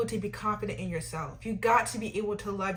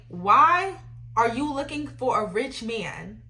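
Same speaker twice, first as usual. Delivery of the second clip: ordinary talk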